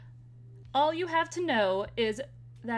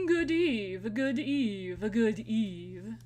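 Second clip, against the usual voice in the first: hammed-up fancy gentleman; grand theatrical greeting; posh greeting voice; much slower than usual. Greeting in a put-on voice.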